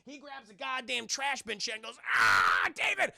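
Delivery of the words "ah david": imitated shout of dismay; exasperated retold yell. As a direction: holler in mock alarm. Retold yell at panicking friend.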